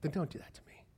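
whispered plea. Acted. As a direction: drop to a soft unvoiced appeal. Pleading plea to an accuser.

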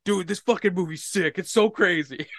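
high, loud gushing over a movie; excited movie fan. Retold or imitated. Retold excitement over favorite movie.

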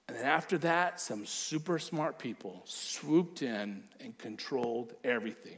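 ordinary talk, nothing marked

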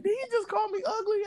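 can't believe what he just heard; stunned disbelief. Retold or imitated. Shocked at friend's insult.

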